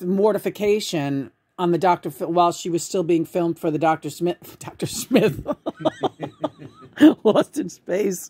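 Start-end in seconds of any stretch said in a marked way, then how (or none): 5.5-6.7 a burst of high, squealing laughter; high-pitched laugh. Real, not acted. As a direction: let the laugh fly up high. Cracking up at own joke.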